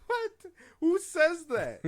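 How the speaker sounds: high, loud disbelieving yelp; shocked disbelief. Real, not acted. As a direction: cry out in disbelief, voice jumping high.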